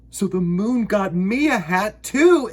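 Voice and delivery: slow, high, loud delight; excited exclaim. Acted. Bear delighted at his new hat, excited exclaim. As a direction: Bear thrilled to find a hat. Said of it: "exclaimed Bear".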